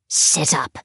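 a lightly voiced, curt monster order; commanding monster. A narrator's reading. Monster to the child in bed: lightly voiced command. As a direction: give the order like a cold creature. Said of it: "commanded one of the monsters".

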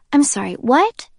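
can't believe what she heard; baffled. Acted. Jay stunned by Kenji's complaint, high baffled question.

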